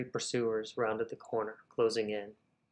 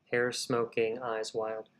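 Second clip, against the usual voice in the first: ordinary delivery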